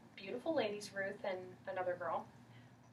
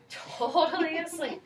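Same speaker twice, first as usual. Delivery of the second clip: laughing through it; 7 semitones higher, 7 dB louder, slower than usual. Real, not acted. Laughing while narrating.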